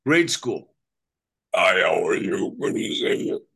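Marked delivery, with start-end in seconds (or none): 1.5-3.4 low, odd backwards-talk voice; backwards speech. Shown as a demonstration. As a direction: speak the words as if reversed. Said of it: "I'd learned how to talk backwards".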